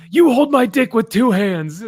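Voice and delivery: high, loud hammed-up order; mock indignant yell. Lobo ordering a guy at the biker bar, mock indignant. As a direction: yell it like an outraged command, playfully.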